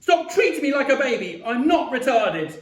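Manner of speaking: high, loud furious protest; shrieking. A narrator's reading. Boy demanding not to be babied, shrieking. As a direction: shriek each line in protest. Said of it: "I shrieked".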